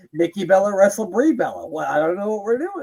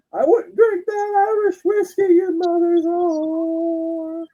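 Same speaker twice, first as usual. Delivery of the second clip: much higher, loud, slow gruff promo voice; a bad, strained Terry Funk growl; Terry Funk impression; 5 dB louder, slower than usual. Host doing a self-admittedly bad Terry Funk impression, loud and high. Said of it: "I can't do a good Terry Funk impression".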